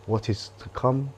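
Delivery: quiet guide fading into stillness; hushed guided meditation. Acted. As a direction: ease them into letting go, softly.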